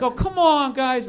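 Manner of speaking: whiny high mock complaint; high-pitched mad boss. Boss fed up with his workers, high-pitched imitation. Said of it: "slightly a high-pitched voice when he would get mad".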